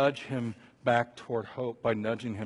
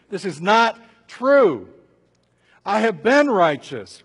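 loud, high, emphatic denial; forceful protest; 5 semitones higher, 11 dB louder, slower than usual. Acted. Job refuting his friends' belief, indignantly loud. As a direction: hammer out each word in protest. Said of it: "this is what Job keeps refuting".

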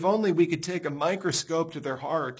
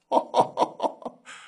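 amused laugh. Real, not acted. Mocking the man's claim.

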